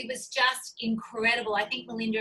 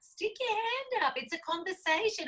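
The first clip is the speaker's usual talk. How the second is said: inviting call; 11 semitones higher than usual. Retold or imitated. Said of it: "I'm like".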